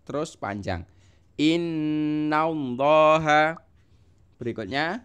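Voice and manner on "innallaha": Innallaha very slow and louder, the nn pressed and held; teacher demonstrating a held nasal; pressed long nn. Shown as a demonstration. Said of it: "Nunnya juga ditekan panjang ya".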